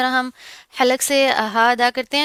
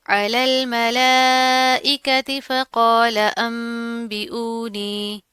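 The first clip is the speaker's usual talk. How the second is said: slow, drawn-out Quran recitation; much slower than usual. Shown as a demonstration. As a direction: recite it slowly as the model for students. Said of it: "इस तरह से पढ़ेंगे". (धीमी तिलावत के अंदाज़ में)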